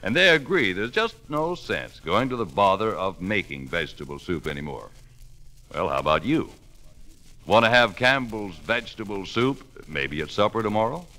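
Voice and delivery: normal talking voice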